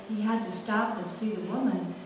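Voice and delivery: usual speaking voice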